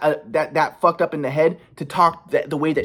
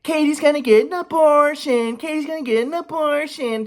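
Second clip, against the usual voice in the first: much higher, slow mock of mom scolding; 12 semitones higher than usual. Son imitates mom scolding him, high mocking voice. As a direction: sneer it as his mom, pitched way up. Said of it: "My mom's like".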